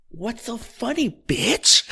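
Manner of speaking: high, confrontational imitation; mock irritated challenge. Daughter confronting a giggling customer, high mock-angry voice. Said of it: "she's getting irritated".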